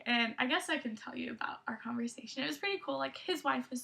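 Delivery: soft whispered aside. Real, not acted. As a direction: lean in and whisper it.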